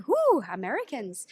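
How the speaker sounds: mock excited cheer. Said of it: "They're very excited".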